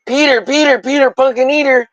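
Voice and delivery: high, teasing sing-song; hammed-up pleading. Streamer teasing Peter about his channel, high and playful. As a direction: coax him like a nursery rhyme. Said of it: "I'm like".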